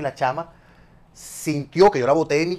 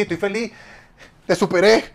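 felicidad fingida; 7 semitones higher, 6 dB louder than usual. Acted. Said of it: "O sea, no puedes decir".

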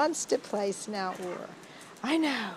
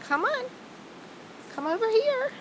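high calling voice; 13 semitones higher than usual. Real, not acted. Calling the dog over.

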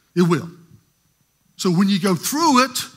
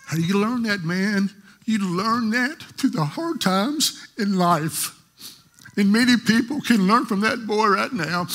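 preaching with rising force; 4 semitones higher than usual. Real, not acted. Preacher to congregation about learning through hard times, impassioned. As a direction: press the lesson home to the church.

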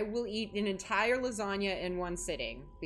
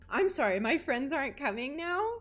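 louder, higher, outraged question; indignant disbelief; 6 semitones higher, 6 dB louder than usual. Real, not acted. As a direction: act betrayed by friends. Indignant at cancelled plans.

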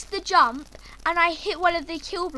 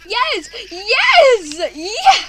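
loud, laughing yes yes yes; laughing cheer; 5 semitones higher, 10 dB louder, much slower than usual. Real, not acted.

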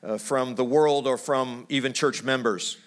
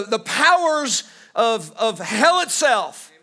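fiery preaching; 6 semitones higher than usual. Acted. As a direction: thunder it from the pulpit, dragging the words. Preacher building to climax.

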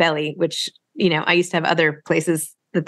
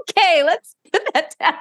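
higher, laughing, caught out, brushing it off; flustered laughing; 10 semitones higher than usual. Retold or imitated. Mother caught by her son reading diaries, laughing flustered. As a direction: laugh it away and change the subject.

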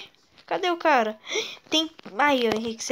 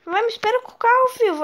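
fast, high, loud plea; being chased; 10 semitones higher, 7 dB louder, faster than usual. Real, not acted. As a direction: rush it out in panic. Fleeing to car under fire. (fugindo, pedindo pro amigo esperar)

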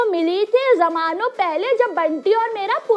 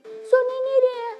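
ordinary delivery

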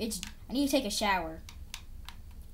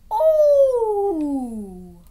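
loud high startled oh; loud high startled exclamation during the game; surprised shout; 7 semitones higher, 10 dB louder than usual. Real, not acted. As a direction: jump at it, loud. Sudden jolt in game.

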